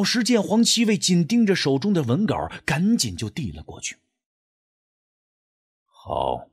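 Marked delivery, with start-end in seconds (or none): 5.8-6.5 cold low reply. Acted. Master answers coldly.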